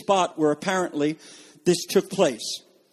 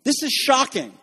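horrified; 8 semitones higher than usual. Retold or imitated. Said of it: "Martha is horrified".

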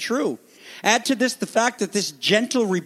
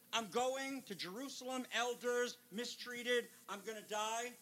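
very soft, slightly raised voice; hushed solemnity; 4 semitones higher, 19 dB quieter than usual. Acted. Jesus telling the twelve he will die, hushed. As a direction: hush it right down for grave words.

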